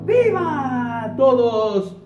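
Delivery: high, loud, drawn-out hurrah; rally everyone with a closing cheer; shouted cheer. Real, not acted. Teacher cheering to end the class song, high loud shout.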